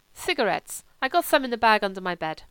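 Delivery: put-on lisping, grumbling German-accented voice; German accent, querulous complaint; complaining Kurt. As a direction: play a sulky German invalid.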